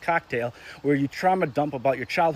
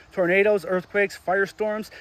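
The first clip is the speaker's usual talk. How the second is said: usual speaking voice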